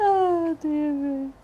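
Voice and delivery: drawn-out, high, through real laughter; slow, crying with laughter; laughing. Real, not acted. Host laughing at the joke.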